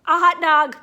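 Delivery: loud, bright joke delivery; telling the riddle answer; punchline. Real, not acted. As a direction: land the punchline with a burst. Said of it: "what do you call a dog".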